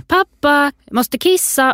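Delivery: imitated child, high and drawn out; child calling out. Parent quoting storybook boy calling from bed, high calling voice. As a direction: call to dad in a high, stretched little voice. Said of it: "Så ropar han".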